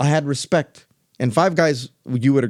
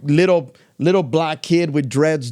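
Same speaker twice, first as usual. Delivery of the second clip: ordinary talk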